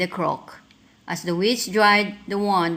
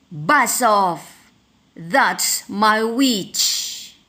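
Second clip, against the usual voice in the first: monster voice; 2 semitones higher, much slower than usual. A narrator's reading. Beast warns the dragon off, slow and growling. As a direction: growl it like a four-headed monster. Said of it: "its terrible voice ... yowl and growl".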